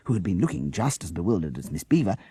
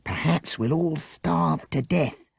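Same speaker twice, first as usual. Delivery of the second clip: solemn; 10 semitones higher, slower than usual. A narrator's reading. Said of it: "said Jessica solemnly".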